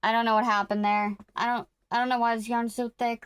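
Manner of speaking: usual speaking voice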